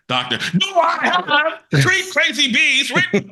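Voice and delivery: mock patient voice. Patient asking the doctor about the door, high laughing voice. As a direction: squeak it out like a giddy patient. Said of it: "And she goes".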